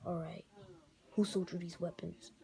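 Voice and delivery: low, quiet, calm Daredevil voice; a lightly deepened hero voice; Daredevil impression. Shown as a demonstration. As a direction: keep it low and hushed like a vigilante. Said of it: "Let's see if I could try".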